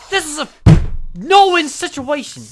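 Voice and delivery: real gamer meltdown; furious shouting. Real, not acted. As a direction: rage at the screen. Pet vanishes, raging gamer.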